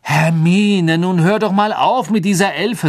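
exasperated. Acted. Ron is fed up with Hermine's elf talk, exasperated. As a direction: plead loudly for her to stop.

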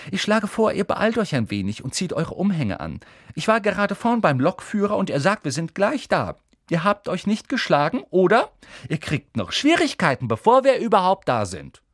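put-on high, brisk know-it-all girl; bossy Hermine. A narrator's reading. Hermine bosses the boys to change into robes, prim. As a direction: lecture the boys.

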